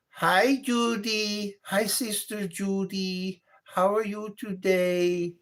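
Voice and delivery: high, slow African woman impression; Sylvia. African woman greeting her friend, high slow imitated voice. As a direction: greet in a drawn-out, high-pitched African accent. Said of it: "And Sylvia sounds like".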